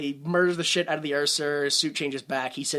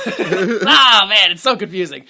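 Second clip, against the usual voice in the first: laughing; 10 dB louder, slower than usual. Real, not acted. Laughing at confusing plot.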